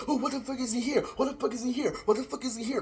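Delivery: high, mocking repeated question; sneering high-pitched mimicry; mock bewildered voice. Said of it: "nobody's gonna be like".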